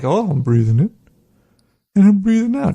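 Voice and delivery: seesaw pitch, echoing the breathing exercise; monkey dutifully narrating each breath; monkey mind breathing along. Acted. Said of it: "the monkey in your mind is like".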